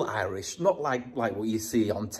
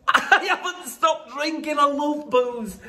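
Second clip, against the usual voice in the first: laughing, pitched way up, slowed down; slower than usual. Real, not acted. Owning up to the camera about booze, laughing. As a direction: giggle through the confession. Jokingly retracting quitting drink.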